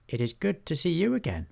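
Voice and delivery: high-pitched goblin voice; respectful goblin. Acted. Hobgob returns his old lord's greeting, high respectful voice.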